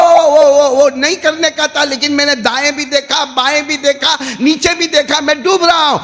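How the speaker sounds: high, urgent acted excuse; panicked confession. Drowning follower admitting he looked around, acted panic. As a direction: blurt it out as if going under.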